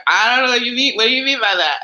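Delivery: mock-confused adolescent; confused teen. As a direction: play a baffled kid who doesn't get the question. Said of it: "And they're like".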